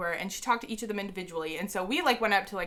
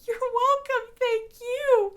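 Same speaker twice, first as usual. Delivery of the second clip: very high squeaky voice; squeaky starstruck; 5 dB louder, much slower than usual. Retold or imitated. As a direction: squeak it out, too starstruck to talk. Starstruck fan thanking [name].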